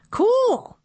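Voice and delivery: put-on thrilled kid's cool; excited cool. A narrator's reading. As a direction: squeal it out with delight. Kid amazed by robot.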